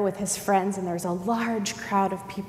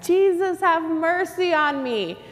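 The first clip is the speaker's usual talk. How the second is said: loud, high-pitched call over a crowd; yelling; 9 semitones higher, 5 dB louder than usual. Acted. Blind man seeking attention in a big crowd, acted yell. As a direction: holler a plea to be noticed. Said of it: "So he yells in this big crowd".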